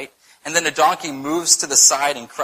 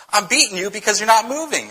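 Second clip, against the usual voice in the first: higher-pitched, indignant comeback; mock exasperation, pitched up; exasperated retort; 8 semitones higher, 4 dB louder than usual. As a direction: argue back as if stating the obvious. Acted quarrel with his donkey.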